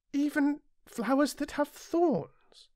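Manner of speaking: put-on high boyish voice, insistent; a small prince presses the pilot with a sudden question; little prince demanding. Narrator voices the little prince, high and insistent.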